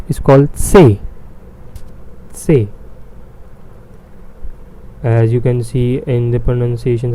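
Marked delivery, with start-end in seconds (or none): 0.6-2.7 Se said as "tseh", t before the s; German letter C. Shown as a demonstration. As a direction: start Se with a crisp "ts", long "eh". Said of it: "I've written a T in front".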